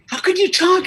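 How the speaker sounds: acted disbelief, pitched high and loud; astonished. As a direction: play the man stunned by a talking dog. Joke's man astonished by talking dog.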